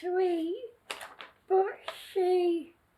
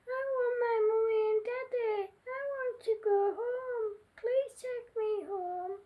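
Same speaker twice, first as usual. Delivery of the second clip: put-on higher, sobbing child's voice fading softer; bursting into tears; 4 semitones higher, 4 dB quieter than usual. Baby told bad news by Willie, high tearful sobbing. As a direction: beg through tears to be taken home. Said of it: "she burst into tears and said".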